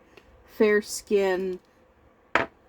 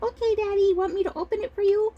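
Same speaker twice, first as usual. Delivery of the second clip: put-on very high, eager little-girl voice; quick, high-pitched child impression; 12 semitones higher, faster than usual. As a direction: imitate a small daughter keen to help dad. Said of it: "And she'd be like".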